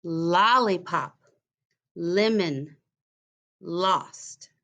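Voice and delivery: lollipop, lemon, lost with the L drawn out slowly; onset stressed words. Shown as a demonstration. Teacher to new pupils, stressing each first sound. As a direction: slowly lean on the L at the start of each word. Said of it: "Let me say it again".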